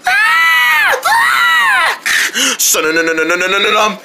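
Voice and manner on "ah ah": high piercing screams of fright; terrified scream. Acted. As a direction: shriek as the spider chases you. Spider chases the children.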